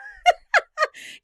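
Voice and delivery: high, helpless burst of laughter; real laughter. Real, not acted. Host cracking up at Carolyn starting to cry, real laughter. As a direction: crack up loudly mid-story.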